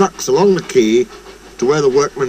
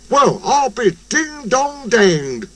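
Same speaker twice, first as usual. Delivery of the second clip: put-on high, slow amazed old guard; astonished guard; 8 semitones higher, slower than usual. A narrator's reading. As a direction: drawl it in astonishment.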